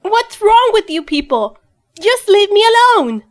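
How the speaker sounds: normal talking voice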